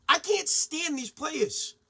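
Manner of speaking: normal talking voice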